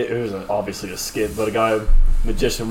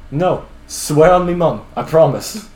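slow, higher, insistent plea; mock pleading; 5 semitones higher, 5 dB louder, slower than usual. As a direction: imitate an earnest oath, drawn out and louder. Magician pleading with cop in skit.

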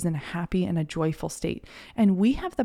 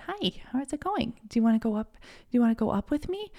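put-on gentle talk to a toddler; 5 semitones higher, faster than usual. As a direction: lightly coax the child to come along. Soothing crying toddler at airport.

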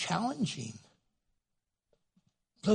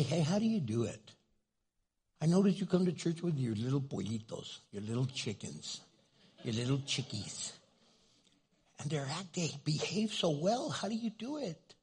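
sweet asking; 6 dB quieter than usual. Retold or imitated. Young mom asking older mom.

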